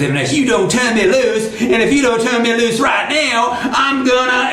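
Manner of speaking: high, mad threat; angry Brer Rabbit. Acted. Stuck rabbit threatens Tar Baby, high and angry.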